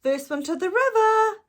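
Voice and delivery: loud, high call to the race; shouting. A narrator's reading. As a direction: yell it out to start the race. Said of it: "Ben shouted".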